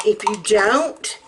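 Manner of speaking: ordinary talk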